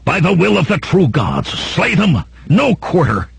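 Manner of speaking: high-pitched war cry; battle cry. A narrator's reading. Attacking hillmen bursting on the sleeping camp, high battle cry. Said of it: "On the heels of the hollow thunk".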